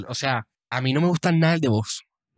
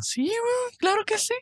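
high squeaky yes; 4 dB quieter, slower than usual. Acted. Teasing insistence on ASMR.

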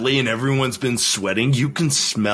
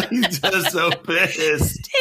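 slow, laughing words; 5 semitones higher, much slower than usual. Real, not acted. Recalling grumpy Chacon to his friend, laughing slowly. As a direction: giggle through the line.

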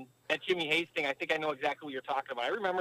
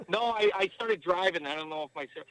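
usual speaking voice

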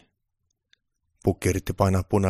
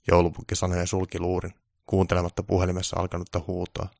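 ordinary delivery

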